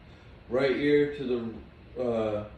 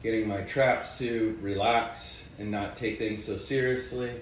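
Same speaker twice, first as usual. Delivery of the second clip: ordinary delivery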